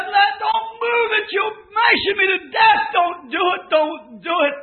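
high, loud sobbing plea; boy crying out as he is crushed; crying boy begging. Retold or imitated. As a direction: beg them to stop, in agony.

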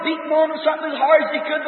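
usual speaking voice

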